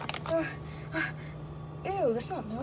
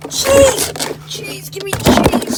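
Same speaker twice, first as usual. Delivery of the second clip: loud, high food craving; excited shouting; 6 semitones higher, 10 dB louder, much slower than usual. Acted. Trapped Mario spotting cheese, excited shouting. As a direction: demand it with greedy excitement.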